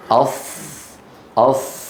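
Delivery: أص with a sharp, hissing emphatic sad; hissing ص demo. Shown as a demonstration. Teacher showing students the whistle of the sad, hissing. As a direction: clip the أص and let the sad hiss. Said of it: "لما يقول المتكلم".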